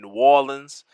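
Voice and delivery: New Orleans in a mock Southern drawl; southern accent. Gamer naming the Saints' city, mock Southern accent. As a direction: put a Southern twang on the city name. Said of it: "I try to say it just like they do down south".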